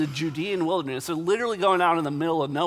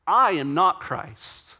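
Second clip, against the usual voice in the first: slow denial with a strong, raised "I"; stress on I; 2 semitones higher, 4 dB louder, slower than usual. Retold or imitated. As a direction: hit "I" first, then slow down. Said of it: "puts the emphasis on, on the I".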